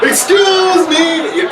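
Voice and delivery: high, very slow, drawn-out shout. Retold or imitated. Desperate guest calling again, a high, drawn-out shout. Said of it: "He's getting louder".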